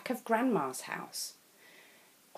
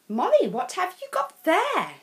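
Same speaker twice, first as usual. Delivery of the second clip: much higher, louder kind teacher; teacher noticing sad Molly, higher; Miss Plumbury; 11 semitones higher, 5 dB louder than usual. A narrator's reading. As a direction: ask gently but high and bright. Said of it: "she asked".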